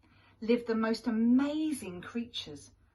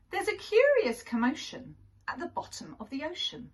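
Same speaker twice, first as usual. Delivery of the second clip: lively, fast storybook read; reading to children; 7 semitones higher, 3 dB louder, faster than usual. A narrator's reading. Teacher reading a picture book aloud to children, lively. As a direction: read it out to little ones with wonder.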